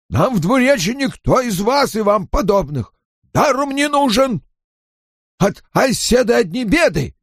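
high, strained ranting at an enemy; angry tirade. A narrator's reading. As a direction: rail at the unwanted outsiders. Angry villager's tirade against Aes Sedai. (гневная речь на повышенных тонах)

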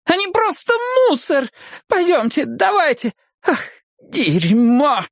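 very high tipsy feminine whine; squealing woman. A narrator's reading. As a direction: fume up high in disgust. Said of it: "взвизгнула женщина".

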